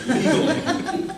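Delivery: stretched out slowly through a laugh; laughing punchline. Real, not acted. Comic laughing over a shady Russian business deal. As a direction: drag the word out and laugh on it.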